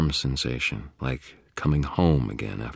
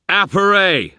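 high, loud spell command; shouted incantation; 11 dB louder than usual. A narrator's reading. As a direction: shout the magic word. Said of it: "I shouted".

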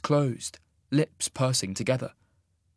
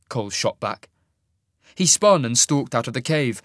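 usual speaking voice

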